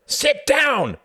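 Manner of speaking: very high, loud screamed order; furious scream. A narrator's reading. Jorgen orders the saluting Count down, furious scream. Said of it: "screamed Jorgen".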